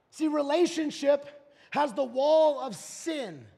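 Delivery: loud, driving list; preacher listing walls to the congregation; full-force preaching. Real, not acted. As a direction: hammer each wall home. Building list, preaching hard.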